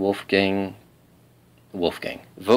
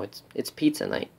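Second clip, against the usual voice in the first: ordinary talk, nothing marked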